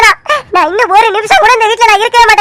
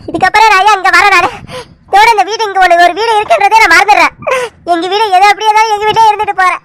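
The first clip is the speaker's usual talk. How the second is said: crying. Acted. Hurt niece leaving, crying.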